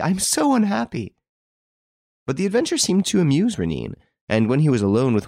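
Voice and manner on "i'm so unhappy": high, mournful complaint; plaintive. A narrator's reading. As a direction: sound lost and unhappy. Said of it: "she said plaintively".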